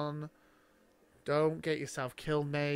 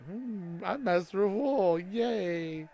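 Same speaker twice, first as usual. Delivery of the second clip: lightly voiced, higher happy Grey Worm; mock Grey Worm; 4 semitones higher, much slower than usual. Mocking Grey Worm's new title, light happy voice. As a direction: tease him with a little cheer. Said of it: "He's like".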